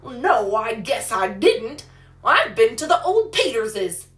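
put-on loud, emphatic boyish protest; indignant retort. A narrator's reading. Joe tells Polly where he has been, indignant. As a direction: deny it loudly, offended. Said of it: "retorted Joe indignantly".

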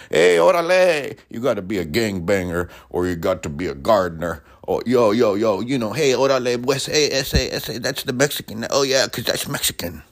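lightly put-on East L A gangbanger accent, mocking how others see Latinos. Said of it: "you gotta have an accent".

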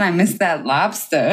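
put-on Boston accent, dropped r; hammy Boston accent, final r gone. Shown as a demonstration. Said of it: "in the Boston accent".

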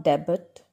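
"debit" as DEB-it, final T sounded; wrong pronunciation. Shown as a demonstration. Teacher warning learners off debut as DEB-it. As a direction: say "debit" with a short ending and T. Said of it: "It's not".

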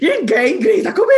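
high, loud protest through laughter; hammed-up indignation, helpless with laughter; laughing mock outrage. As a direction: shout it while cracking up. Repeats the outrage while laughing.

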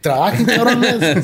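high and slow, laughing; laughing mock order. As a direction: bark it out while laughing. Joking order through laughter.

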